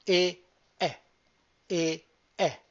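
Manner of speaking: "E" closed, "è" open, each said apart and loud; don't merge "e" and "è"; separate units. Shown as a demonstration. Teacher drilling "e" and "è" as separate units. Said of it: "Break them down as units".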